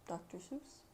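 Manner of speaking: plain reading voice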